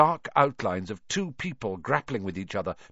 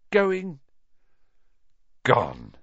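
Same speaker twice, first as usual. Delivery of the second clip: slow fading; 4 semitones higher than usual. A narrator's reading. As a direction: let it drop at the end. Dragon leaving.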